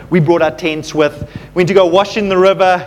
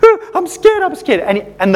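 high mock whimper with a laugh; a frightened big guy, mimicked with a laugh; imitated whimper; 9 semitones higher than usual. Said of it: "was whimpering".

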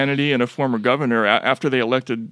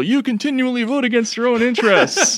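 high, laughing mock shout; mock heel shout; 12 semitones higher, 3 dB louder than usual. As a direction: yell it at the crowd like a heel. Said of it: "He just shouts at people".

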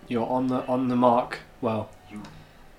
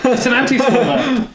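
laughing; 10 semitones higher, 5 dB louder than usual. Real, not acted. Laughing correction.

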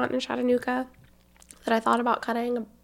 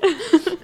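high giggle; 11 semitones higher, 7 dB louder than usual. Real, not acted. Laughing at her cousin.

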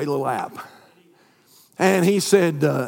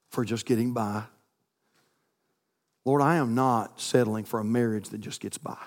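prayer; 2 semitones lower than usual. Acted. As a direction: pray it sincerely to close. Closing prayer over music.

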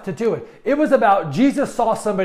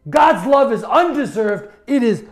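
fired-up preaching, louder and punchy; preaching at full force; 2 semitones higher, 4 dB louder than usual. Acted. Pastor to his church about grace, in a fired-up preaching voice. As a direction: preach it out with rising fervor.

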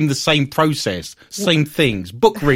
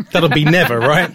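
ordinary talk, nothing marked

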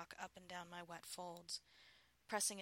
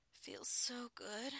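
slow, higher, whispery delight; pleasured sigh; 5 semitones higher, much slower than usual. Acted. Wife answering her husband, sighing with pleasure. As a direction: sigh it out slowly, pitch lifted.

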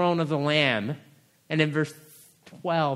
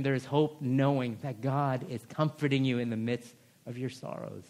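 usual speaking voice

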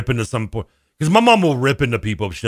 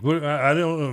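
ordinary delivery

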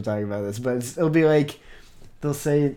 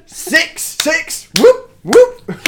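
mock cheering; 13 dB louder, much slower than usual.

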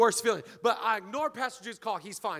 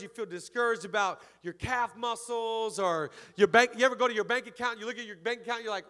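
ordinary delivery

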